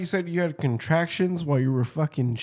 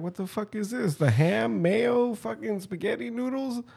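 mock disgust at food; 8 semitones higher than usual. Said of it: "I'm like".

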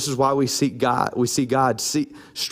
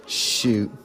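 whispered shoot; 7 semitones lower than usual. Acted. Voicing Tamar's resolve.

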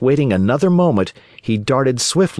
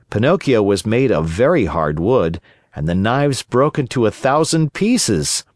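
plain reading voice

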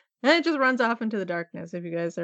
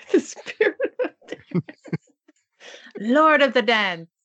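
laughing grandly; 6 semitones higher, 4 dB louder, slower than usual. Real, not acted. Laughs while mock-announcing the dance spirit.

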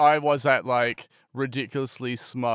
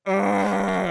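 higher-pitched imitated groan; mock collective groan; 7 semitones higher than usual. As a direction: groan like an exasperated queue.

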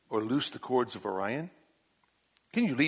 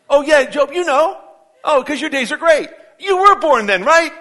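mocking, much higher and louder sarcastic voice; holy sarcasm; 13 semitones higher, 13 dB louder than usual. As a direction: sneer it at Job in big mock disbelief. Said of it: "That's holy sarcasm".

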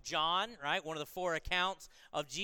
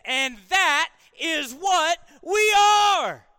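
booming, slow, much higher and louder proclamation; read with an exclamation point; 10 semitones higher, 15 dB louder, much slower than usual. Shown as a demonstration. Preacher to the congregation, reading the verse as a joyful exclamation. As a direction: shout every word as a joyful exclamation. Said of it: "So are you ready? Here it goes".

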